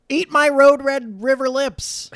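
high, loud, slow Eddie voice; hammed-up high Eddie line; Eddie impression. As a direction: bellow it like Eddie. Quoting Eddie.

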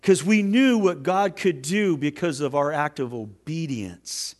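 normal talking voice

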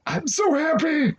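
slow, high, tearful happiness; half crying with joy; slow, high, voice breaking with happy tears; teary joy. Real, not acted. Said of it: "[name] just broke".

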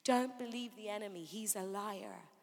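ordinary talk

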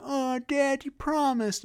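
put-on high pleading child voice; mock child whining to his dad; whiny kid. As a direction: beg like a let-down little kid. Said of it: "He's like".

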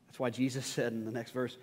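ordinary talk

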